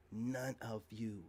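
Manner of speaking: none of you, quiet and very slow; slow cold dismissal. Real, not acted. Telling women online he ignores them, quiet and slow. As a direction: let it drop quietly, one word at a time.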